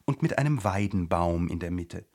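ordinary delivery, nothing marked

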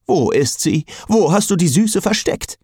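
greedy, searching magic sword; eager sniffing sword. A narrator's reading. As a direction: sniff around like an eager dog. Sword Jack sniffing around eagerly.

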